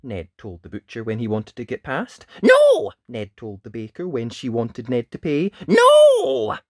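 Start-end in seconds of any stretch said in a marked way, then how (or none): 2.4-2.9 very loud, high no; stubborn refusal. A narrator's reading. Ned refusing the baker, loud high shout. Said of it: "The no's came thick and fast". 5.7-6.6 long, high, loud no; stubborn refusal. A narrator's reading. Ned refusing the fisherman, loud high shout. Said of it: "The no's came thick and fast".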